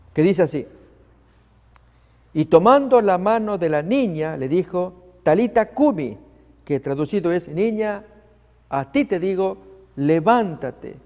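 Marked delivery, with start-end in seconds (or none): 2.3-6.2 loud, solemn Bible reading; scripture reading. Acted. Preacher reading Luke aloud, solemn and loud. 7.5-11.0 solemn command, levántate slowed; scripture reading. Acted. Preacher reading Jesus' command to the girl, solemn.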